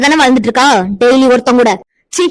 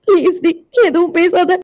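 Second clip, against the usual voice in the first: crying and pleading, slow and higher; tearful plea; 6 semitones higher, slower than usual. Acted. Amirtha begs her friend to stop, crying. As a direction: beg her to stop through sobs.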